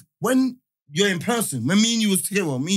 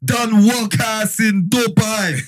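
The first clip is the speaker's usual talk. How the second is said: loud hype-man introduction, words stretched out; big intro; 5 dB louder, slower than usual. Pretend. Co-host hyping up the guests, big drawn-out intro. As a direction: announce him like a party MC, drawing it out. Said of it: "Give the big intro".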